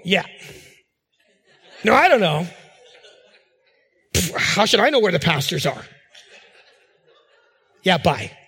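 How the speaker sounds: mock can't-be-bothered phone answers; curt receptionist imitation. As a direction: play an unhelpful receptionist, huffing at the caller. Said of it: "weird phone mannerism".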